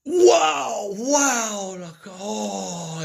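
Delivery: imitated high, loud wails of wonder; mind-blown screaming. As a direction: wail it like someone utterly blown away, easing off at the end. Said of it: "and mind fucked he was".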